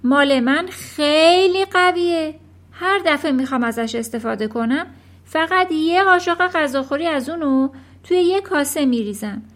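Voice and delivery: loud, indignant wife's lecture; exasperated scolding. A narrator's reading. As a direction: berate your husband for his stupidity. Mother scolds foolish husband.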